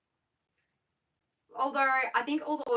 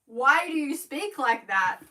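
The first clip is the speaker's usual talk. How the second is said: imitating a critic, loud and higher; slow, exaggerated, loudly mocking question; mock critical commenter; 4 semitones higher, 8 dB louder, slower than usual. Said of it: "the only mean comments".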